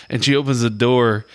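normal talking voice

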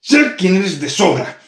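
slow, loud, high demon snap; snapping demon. Acted. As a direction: spit each word at her. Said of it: "espetó el demonio".

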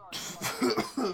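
sudden choking cough; coughing on a fly. Real, not acted. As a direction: cough out a swallowed bug. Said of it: "a fly just flew into my mouth".

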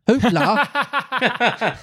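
high laughing Hoopla. A narrator's reading. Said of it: "he cried gaily".

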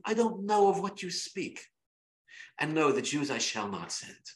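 polite disdainful Paro. Retold or imitated.

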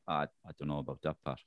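embarrassed, low, soft mumble; shyly deflect, low and quiet; Roy Keane. Acted. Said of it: "in kind of an embarrassed way".

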